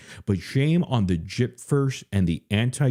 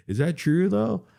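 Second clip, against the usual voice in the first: disbelieving; 10 semitones higher than usual. Real, not acted. Disbelieving question to chat.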